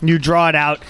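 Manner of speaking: normal talking voice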